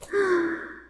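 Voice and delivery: high gasp of delight. Acted. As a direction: gasp at an adorable sight. Reveals the portrait, delighted gasp.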